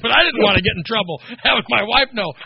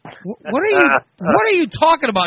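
much higher, playing dumb in alarm; feigned innocence; 10 semitones higher than usual. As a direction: act clueless and startled to fool the wife. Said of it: "And I go".

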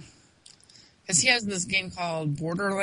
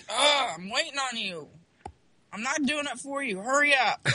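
higher-pitched exasperated nagging; imitated impatience; 6 semitones higher than usual. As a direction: mildly mimic someone fed up with waiting. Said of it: "he's, like, yelling at me".

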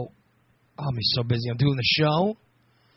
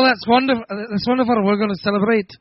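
higher, lilting attempt at an Irish accent; mock Irish accent; 4 semitones higher than usual. Irish people thrilled to celebrate, in a mock Irish accent. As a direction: play delighted Irish folk in a put-on brogue. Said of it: "for the Irish people go".

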